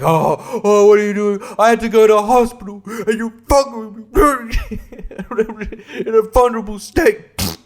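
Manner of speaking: high, loud, hammed-up tirade breaking into laughter; outraged. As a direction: wail the complaint like you've been wronged. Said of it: "outraged because it's like".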